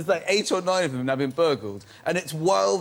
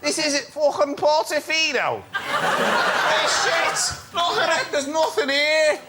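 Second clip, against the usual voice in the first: put-on higher, laughing Liverpool accent; Scouse accent; 8 semitones higher than usual. Comedian mocking Portofino in a Liverpool accent. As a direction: sneer at the town in broad Scouse. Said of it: "You would make an excellent Scouser".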